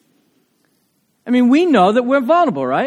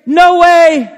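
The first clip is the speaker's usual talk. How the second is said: loud high no way; emphatic no way; 9 semitones higher, 9 dB louder than usual. Retold or imitated. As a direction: slam the door on it. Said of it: "Very emphatic".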